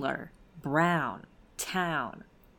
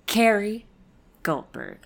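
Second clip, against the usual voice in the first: normal talking voice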